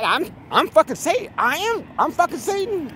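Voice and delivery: high, incredulous squeal of disbelief; mock disbelief. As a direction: shriek it like you can't believe it. Mock shocked reaction to being Satan.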